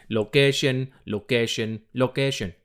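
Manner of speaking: playful mock-French pronunciation; French accent. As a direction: put on a light French accent. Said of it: "I started talking French".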